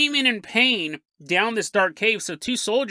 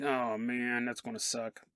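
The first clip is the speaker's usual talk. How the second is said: much lower, very quiet and glum; 9 semitones lower, 13 dB quieter than usual. Acted. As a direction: mutter it low and glum. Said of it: "Bigfoot in the back seat is like".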